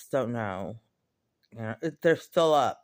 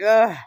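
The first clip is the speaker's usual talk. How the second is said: loud, raised grunt of disgust; disgusted ugh; 6 semitones higher, 9 dB louder than usual. Acted. Imagining being bitter and mean, disgusted groan. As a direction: groan it out, fed up.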